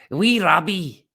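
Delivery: light Scottish brogue; Scottish accent. Shown as a demonstration. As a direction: say it like a proud Scot. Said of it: "That's my pure Scottish voice".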